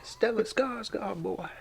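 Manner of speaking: slow, high sing-song on the name; mock drawn-out name. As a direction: stretch the name out teasingly. Running joke on a name.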